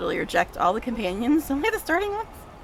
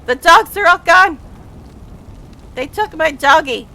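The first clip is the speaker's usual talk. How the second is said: dismayed shout; 5 semitones higher, 9 dB louder than usual. Real, not acted. Finds her dogs missing.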